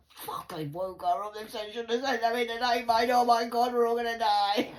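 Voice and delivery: mocking Laura's panic. Imitating daughter who woke mum, mock terror. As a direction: ham up her frightened whisper-turned-shriek. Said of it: "Laura will be sitting at home".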